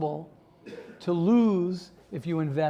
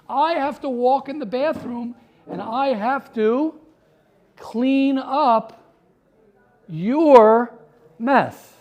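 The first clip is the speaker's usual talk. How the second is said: loud, higher, each phrase driven home; exasperated complaint; 5 semitones higher, 6 dB louder, slower than usual. Real, not acted. Teacher griping about cleaning up after others, exasperated. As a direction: raise your voice and space out the final words.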